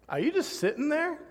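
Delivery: high-pitched incredulous question; puzzled wife. Retold or imitated. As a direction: imitate a baffled wife, pitched well up. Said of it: "my wife comes up".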